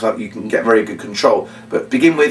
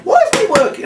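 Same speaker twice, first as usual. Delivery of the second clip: much higher, hammed-up frustration; mock cross. Shown as a demonstration. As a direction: play at getting cross with the box. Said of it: "Getting a bit cross".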